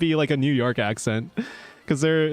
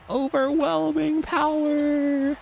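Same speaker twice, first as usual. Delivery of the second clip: awed exclamation; much slower than usual. Acted. Character recalling Teio's race, slow awed high cry. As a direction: savour every word in awe.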